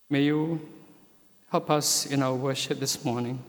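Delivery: ordinary talk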